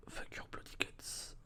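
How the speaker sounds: usual speaking voice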